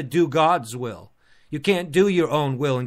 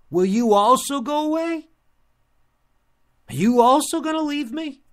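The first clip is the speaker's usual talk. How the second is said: raised, high questioning voice held up to the end; Jesus's pained question; 8 semitones higher than usual. Retold or imitated. Jesus to his own disciples, high pleading question. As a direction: ask it high and drawn upward, as a quoted appeal.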